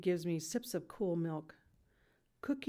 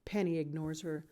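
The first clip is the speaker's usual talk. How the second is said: ordinary delivery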